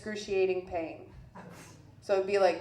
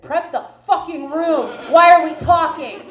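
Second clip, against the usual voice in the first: loud, raised-pitch demand; urgent yelled order; 6 semitones higher, 11 dB louder than usual. Retold or imitated. Patient demanding a C-section without a second's wait, urgent yell. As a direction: yell it impatiently, building louder.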